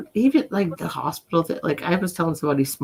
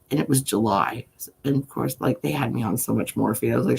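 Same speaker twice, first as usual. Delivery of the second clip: ordinary talk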